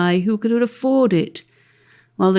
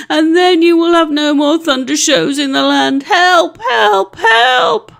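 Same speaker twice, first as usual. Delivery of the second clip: much higher, louder panicked screams for help; screaming; 8 semitones higher, 6 dB louder than usual. Acted. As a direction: shriek it as a coward running for his life.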